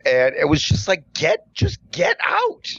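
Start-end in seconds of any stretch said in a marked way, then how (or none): 1.1-2.7 very high, stretched exasperated command; squeaky-high mock order, slow and insistent; retold flustered shooing. Barista shoos a customer like geese, flustered and exasperated. Acts out shooing intruder away.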